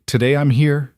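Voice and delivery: put-on a six-year-old reciting a ritual phrase; little girl voice. A narrator's reading. Lily quotes the family game.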